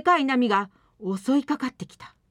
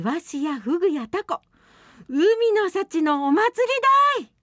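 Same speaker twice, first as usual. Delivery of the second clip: jubilant; 8 semitones higher, 5 dB louder than usual. Acted. Fisherman celebrating the catch.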